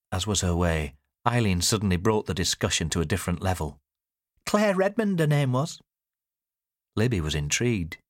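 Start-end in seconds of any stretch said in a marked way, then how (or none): 4.5-5.8 high-pitched mother's voice; name her pointedly, high; high-voiced disclosure; mother naming a rival. A narrator's reading. Mother names the neighbour.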